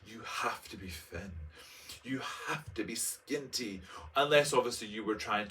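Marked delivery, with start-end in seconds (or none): 0.0-3.8 softer, slow insistent demand; pressuring voice of society. Retold or imitated. Society telling a teen to be thin, softer and slow. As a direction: drone it like a nagging rule. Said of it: "it was like".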